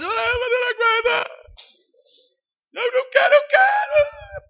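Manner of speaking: very high crying wail; hammed-up tearful cry; mock sobbing wail. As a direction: whine like a weeping child. Imitating the sobbing person.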